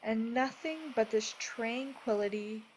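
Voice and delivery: measured, soothing delivery, each phrase given room; guided meditation. Acted. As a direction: guide them gently into peace, unhurried.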